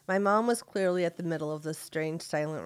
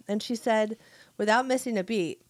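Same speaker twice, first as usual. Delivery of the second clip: ordinary delivery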